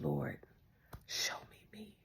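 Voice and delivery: low, whispered plea to God; intimate, barely voiced prayer; hushed prayer. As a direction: steal away and whisper it. Retold private prayer after stealing away.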